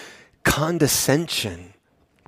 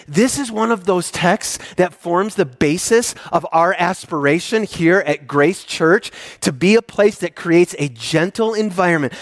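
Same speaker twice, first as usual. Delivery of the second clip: usual speaking voice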